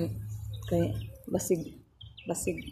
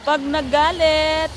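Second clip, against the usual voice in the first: nagalit louder and slow; stress on nagalit; 4 semitones higher, 10 dB louder, slower than usual. Real, not acted. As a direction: hit "nagalit" loud and slow. Emphatic loud word.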